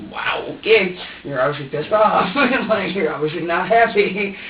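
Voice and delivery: incredulous, fed-up reaction; exasperated disbelief. Retold or imitated. Exasperated talk to baby.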